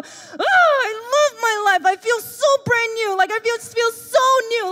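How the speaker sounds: high, loud, gushing delight; blissful relief. Retold or imitated. Fresh out of the shower, gushing to the congregation, blissful. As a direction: swoon like the shower made you new. Towel on face after shower.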